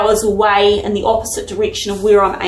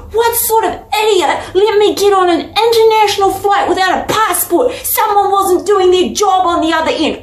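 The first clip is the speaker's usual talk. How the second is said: mad rant; 8 semitones higher than usual. Retold or imitated. Dreamer fuming at careless airport staff, high indignant rant. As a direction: rant about whoever let her fly without a passport. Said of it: "And I was so mad too".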